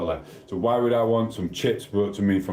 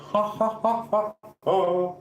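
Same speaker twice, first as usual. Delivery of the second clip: real high-pitched cackle of delight; high laugh; 12 semitones higher than usual. Real, not acted. Streamer cracks up at a viewer's jog on, laughing high.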